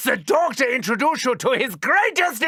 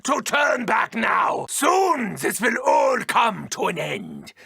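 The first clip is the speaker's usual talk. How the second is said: ordinary talk, nothing marked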